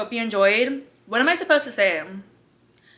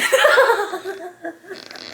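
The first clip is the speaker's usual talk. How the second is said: high, trailing fit of giggles; real laughter; 9 semitones higher than usual. Real, not acted. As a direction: crack up at your own melodrama. Cracks up at own speech.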